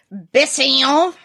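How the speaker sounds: slow, lightly put-on French accent; hesitant attempt at a French accent. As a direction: draw the place name out in amateur French. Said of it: "I'm just prepping my French accent".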